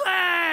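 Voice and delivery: high, loud imitation of screaming children; mock kids' scream. Kids hear Chuck E. Cheese is dead, mock horrified scream. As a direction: shriek like a room of horrified kids. All the kids react in horror.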